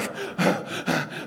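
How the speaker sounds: heavy winded huffs; acted panting. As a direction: mime being out of breath from sprinting. Runners arrive breathless at door.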